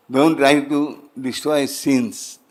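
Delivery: slow, weighted warning on Don't; slow emphatic teaching. Shown as a demonstration. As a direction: drive the key sentence home slowly. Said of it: "Remember this sentence".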